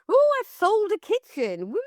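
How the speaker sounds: high, hammed-up cheer; mock excited kitchen brag; mock celebration. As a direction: squeal it like a silly brag. Mock social media celebration.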